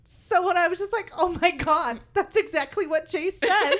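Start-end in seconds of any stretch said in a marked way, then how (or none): 1.2-3.8 loud, higher fangirl squeal-talk; a reader telling friends how she freaked out; giddy excitement. Retold or imitated. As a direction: gush about the book. Excitedly retelling reading reaction.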